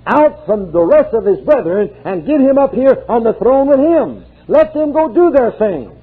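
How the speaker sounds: high, ringing preacher's cry; fervent revival preaching; preaching at full force. Acted. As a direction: preach it out, lifting the congregation.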